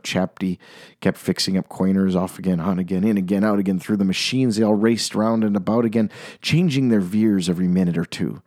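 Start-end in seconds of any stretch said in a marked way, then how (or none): none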